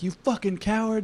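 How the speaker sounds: high-pitched retold insult shouted; mock yell. Natives yelling at the general from the forest, mock yell. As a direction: throw the insult up high like an angry native warrior.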